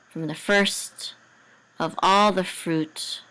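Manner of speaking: ordinary delivery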